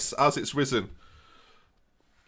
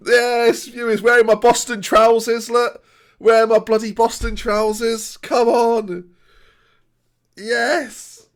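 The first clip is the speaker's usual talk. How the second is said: celebratory; 6 semitones higher, 6 dB louder, slower than usual. Real, not acted. Celebrating in Boston trousers.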